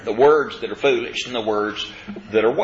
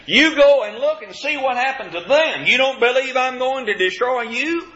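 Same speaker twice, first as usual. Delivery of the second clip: much higher, insistent prophet's challenge; emphatic warning; 9 semitones higher than usual. Acted. Jeremiah warning the southern kingdom, high and emphatic. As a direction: challenge them in a raised pitch.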